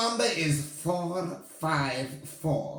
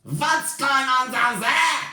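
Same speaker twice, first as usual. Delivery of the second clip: loud, slow witch demand; shouting; 5 semitones higher, 5 dB louder than usual. Acted. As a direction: shout down from the platform. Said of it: "shouted the Grand High Witch".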